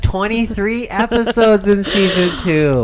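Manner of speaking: high, loud and incredulous; exasperated. Real, not acted. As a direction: protest in disbelief. Exasperated argument.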